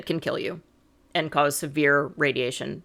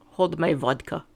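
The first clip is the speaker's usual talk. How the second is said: put-on mild, jokey Russian accent; a light comic Russian accent on a punchline; 3 dB quieter than usual. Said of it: "that's my best Russian accent".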